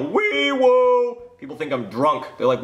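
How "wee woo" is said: high, loud mouth-made police siren; yelled siren imitation. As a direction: yell it like a siren out the window. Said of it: "stick my head out the window and yell".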